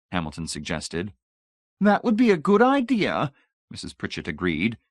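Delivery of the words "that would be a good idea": high, loud delighted agreement; bright-eyed enthusiasm. A narrator's reading. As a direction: agree eagerly in a much higher voice. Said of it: "bright-eyed with enthusiasm".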